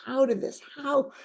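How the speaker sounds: high, slow, pleading questions; imitating a distraught client. Counselor mimicking the distraught client's pleading, high and slow. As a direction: let the voice climb as she despairs. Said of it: "just kept saying that".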